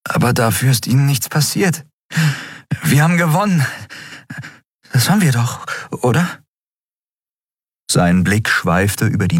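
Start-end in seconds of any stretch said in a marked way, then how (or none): 0.1-6.4 put-on high young voice with a smile; weak smile. A narrator's reading. Miko tries to smile as he asks if they won. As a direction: smile through the pain. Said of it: "Er versuchte ein Lächeln".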